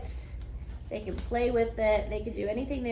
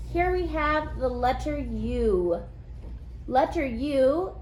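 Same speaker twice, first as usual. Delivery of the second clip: put-on kids teacher voice; 3 semitones higher than usual. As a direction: announce it to the class. Teacher addressing class on video.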